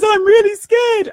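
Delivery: high, slow, trembling fear in her voice; imitating a scared crying girl. Retelling a frightened stranger at the hot dog stand, imitated scared voice. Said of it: "she's crying".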